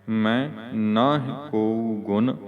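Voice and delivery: slow, spaced-out words up to ਗੁਣ; deliberate model reading halting at ਗੁਣ; pause after ਗੁਣ. Shown as a demonstration. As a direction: separate each word and rest on ਗੁਣ. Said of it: "Vishram".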